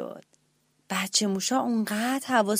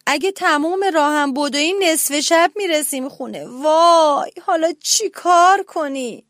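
anxious wail; 5 semitones higher, 5 dB louder than usual. A narrator's reading. Mouse panics about the distance.

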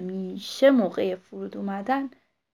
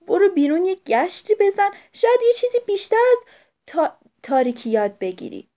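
scolding mother; 4 semitones higher, 8 dB louder than usual. Acted. Mother scolds owlet awake.

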